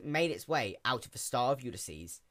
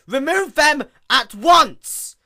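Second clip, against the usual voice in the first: high, slow, loud royal order; furious command; 18 dB louder, much slower than usual. A narrator's reading. Princess loudly orders the beings removed. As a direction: command it in outrage.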